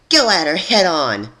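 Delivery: high, loud battle urging; rally them into the attack; urging shout. Acted. Rebel urging a head-on attack, loud shout.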